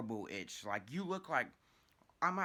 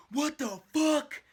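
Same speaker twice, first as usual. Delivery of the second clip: loud, high, drawn-out yell of disbelief; shocked shout; 13 semitones higher, 10 dB louder, slower than usual. Retold or imitated. Friend opens the door on the scratching teller, shocked shout. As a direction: act out a friend yelling in shock.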